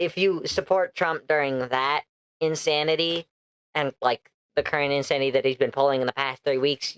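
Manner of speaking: ordinary talk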